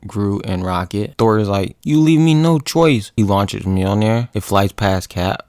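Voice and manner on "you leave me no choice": raised, high, loud declaration; dramatic mock-heroic shout; acted battle warning. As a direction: declare it like a hero about to attack.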